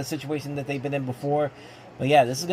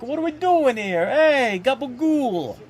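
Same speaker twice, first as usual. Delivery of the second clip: much higher mock New York Italian accent, hamming the gabagool; 10 semitones higher, 5 dB louder, slower than usual. Streamer jokes the quarterback sounds like a New Yorker, hammed-up New York accent. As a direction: play it like a cartoon New Yorker throwing up his arms. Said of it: "speaking like he's in New York".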